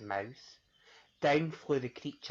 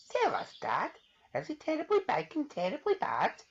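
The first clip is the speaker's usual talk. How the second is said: put-on high child voice; eager little questioner; curious child; 14 semitones higher than usual. Child begging her dad for details, high.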